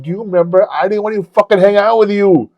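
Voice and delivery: loud, high retold reminder; insistent. Man reminding his friend he snubbed him, insistent. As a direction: press the point at full voice. Confronting friend about the past.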